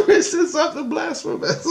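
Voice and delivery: put-on high child voice breaking into laughter; squeaky tattling kid, half laughing; imitated little girl. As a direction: mimic a tiny tattletale while cracking up. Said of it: "She goes".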